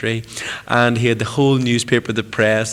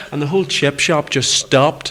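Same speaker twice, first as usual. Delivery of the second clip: normal talking voice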